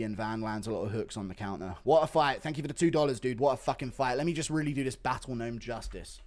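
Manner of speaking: normal talking voice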